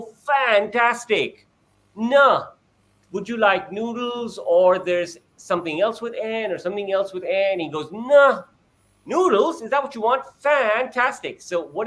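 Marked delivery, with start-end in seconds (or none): 0.0-7.6 much higher, overjoyed, stretching out fantastic; a parent thrilled at the child's first no; celebrating parent. Retold or imitated. 7.9-11.4 much higher, overjoyed, stretching out fantastic; a parent thrilled at the child's first no; celebrating parent. Retold or imitated.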